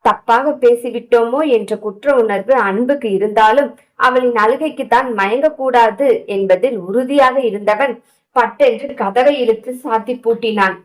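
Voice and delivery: ordinary delivery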